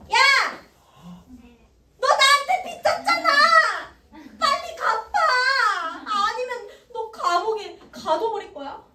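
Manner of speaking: shrill, high yell; furious yelling. Acted. Forgiven servant yelling at his debtor friend, high shrill fury. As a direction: scream at the debtor to pay up. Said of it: "이렇게 말한 거야".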